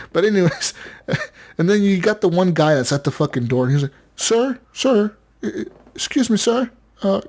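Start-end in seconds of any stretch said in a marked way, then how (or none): 4.2-7.2 higher, stammering mock salesman; pushy salesman. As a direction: pester them like the guy at the door. Imitates salesman at Walmart door.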